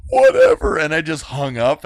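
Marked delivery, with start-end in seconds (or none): 0.1-0.8 mock tearful whatever. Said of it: "And I was like".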